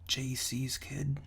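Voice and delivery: ordinary talk, nothing marked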